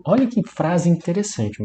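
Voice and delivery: ordinary talk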